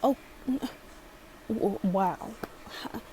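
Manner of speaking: usual speaking voice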